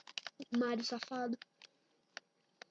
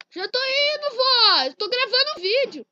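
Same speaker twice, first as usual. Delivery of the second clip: loud call to someone in another room, higher and louder; 5 semitones higher, 10 dB louder, slower than usual. Real, not acted. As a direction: shout it across the house. Calling out to grandma in another room.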